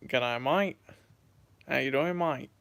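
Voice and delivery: amateur Aussie accent, broad vowel on mate; attempted Australian accent. Shown as a demonstration. Streamer trying to sound like an Aussie for viewers, Australian accent. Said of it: "I can try and sound like an Aussie".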